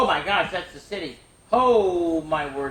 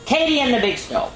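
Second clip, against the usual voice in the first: title called out higher and louder; storybook title announcement; announcing title; 9 semitones higher, 6 dB louder than usual. Acted. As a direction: present the book with flair.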